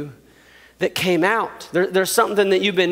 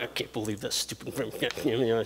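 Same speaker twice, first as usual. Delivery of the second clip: low, soft grumble to himself; sulking brother mutter; 9 semitones lower, 13 dB quieter than usual. Retold or imitated. Brother sulking alone in his room, low grumble. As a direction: mutter it under your breath like a sulky teen. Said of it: "He just sits in his room like".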